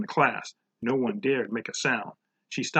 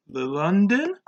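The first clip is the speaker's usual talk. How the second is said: higher, nervous rising question; hesitant guess climbing in pitch, louder; 6 semitones higher, 5 dB louder, slower than usual. A narrator's reading. Leslie answering the capital question, nervous. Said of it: "Leslie said nervously".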